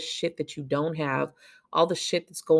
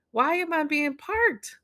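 incredulous; 10 semitones higher than usual. Retold or imitated. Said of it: "and it's like".

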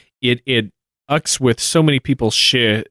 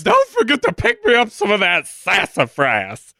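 queen voice; 7 semitones higher than usual. Retold or imitated. Voicing the queen's order.

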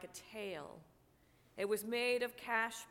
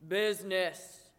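booming ghost outcry; ghost crying out; 2 semitones lower, 8 dB louder than usual. Acted. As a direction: hurl the word back in anguish. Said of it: "cried the ghost".

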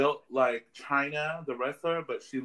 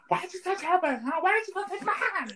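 indignant high-pitched shout; mock outraged yell. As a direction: yell it at the guy who touched her. Retold scolding of a groper.

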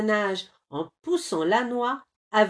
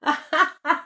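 high, loud burst of giggling; giggle fit; 8 semitones higher, 6 dB louder than usual. A narrator's reading. Squirrel laughing at the bear, giggling fit. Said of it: "L'écureuil a le fou rire".

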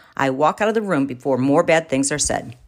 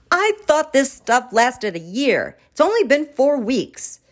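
higher, slowed, aghast disbelief; dismayed protest; 8 semitones higher, slower than usual. Acted. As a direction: drag it out, stunned. Husband protests to wife.

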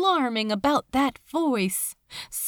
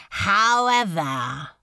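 ominous demon lord; 4 dB louder, slower than usual. Acted. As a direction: stretch the word, let the threat hang. Beelzebub's ominous however.

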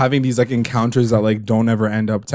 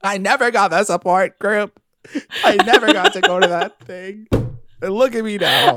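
high-pitched melodramatic whine; hammed-up self-pity, high voice; mock distraught mother; 8 semitones higher than usual. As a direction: imitate a mom wailing her grievances. Both mimic Alice's tearful tirade.

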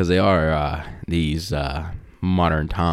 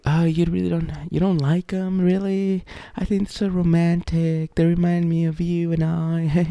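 put-on much higher, sing-song swooning girlfriend voice; gushing girlfriend impression; 11 semitones higher than usual. Girlfriend gushing to him about romantic movies, high and dreamy. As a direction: gush over romance like a smitten girlfriend. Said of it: "she's always like".

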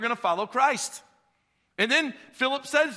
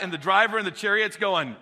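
ordinary delivery

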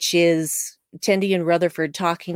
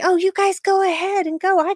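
a higher put-on voice for the helpful guy; eager Fletcher voice; 12 semitones higher, 4 dB louder than usual. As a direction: sound eager to take it all on. Fletcher voiced in a retold scene.

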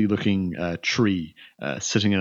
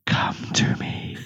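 slow whispered beckoning; eerie, breath-only call; whispering spirit voice; slower than usual. Acted. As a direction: repeat the summons in a drawn-out whisper. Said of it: "you hear a whispering in your mind".

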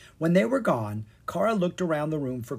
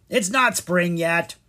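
slow grumpy frog croak; 6 semitones higher, 7 dB louder than usual. Acted. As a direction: grumble like a sleepy frog. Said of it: "one of the frogs croaked".

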